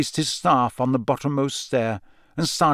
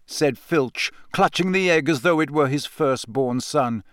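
ordinary delivery, nothing marked

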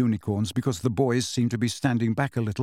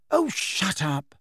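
angry; 6 semitones higher, slower than usual. A narrator's reading. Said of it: "said Hermione angrily".

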